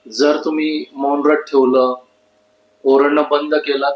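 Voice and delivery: usual speaking voice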